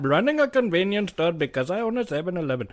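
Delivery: put-on high, far comic Indian accent; hammed-up shopkeeper Indian accent. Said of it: "It's like doing the Indian accent".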